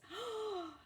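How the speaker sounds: sharp high gasp of wonder; amazed gasp. Acted. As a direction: gasp in delight. Oscar sees his new look.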